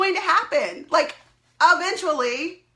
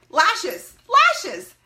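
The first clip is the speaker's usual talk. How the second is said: exasperated exclaim; 4 semitones higher, 5 dB louder than usual. Real, not acted. Exasperated emphasis.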